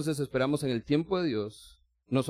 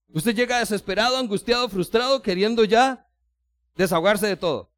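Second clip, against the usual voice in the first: fervent preaching; 6 semitones higher, 6 dB louder than usual. Acted. Preaching at full force.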